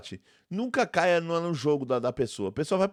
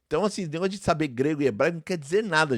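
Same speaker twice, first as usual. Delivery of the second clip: ordinary delivery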